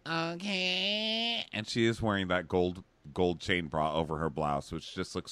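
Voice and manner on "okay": a lightly voiced high, meek okay; small high okay, playing the hurt girlfriend; mock Christina. Christina answering Dean's brush-off, lightly voiced high. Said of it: "And she's like".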